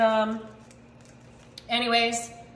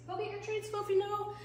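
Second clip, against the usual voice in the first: cooing pet talk; 8 semitones higher, 9 dB quieter than usual. Real, not acted. Owner sending the dog to its treats, high soft coo. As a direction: softly coo the dog along.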